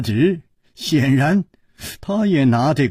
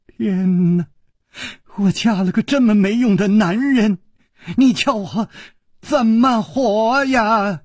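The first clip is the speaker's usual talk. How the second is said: wailing cry; 7 semitones higher than usual. A narrator's reading. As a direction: wail to the heavens. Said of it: "忽然放声大哭". (放声大哭地哀嚎)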